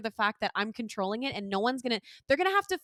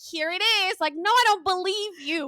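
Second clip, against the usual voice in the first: exasperated disbelief; 7 semitones higher, 6 dB louder than usual. Retold or imitated. Acted rant against airline claim.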